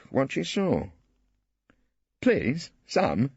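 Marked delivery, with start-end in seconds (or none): 2.2-3.3 coy, playful correction; skittishly. A narrator's reading. Ballard coaxing Phillida to use his name, skittish. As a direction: flirt a little as you correct her. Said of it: "he corrected her skittishly".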